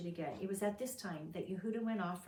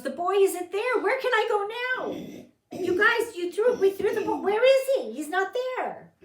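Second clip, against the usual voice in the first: put-on high, loud, frantic voice of Reuben searching for the boy; a brother yelling in alarm, higher and louder; panicked cry; 12 semitones higher, 13 dB louder than usual. Reuben tells his brothers the boy is gone, panicked shout. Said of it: "he returned to his brothers and he goes".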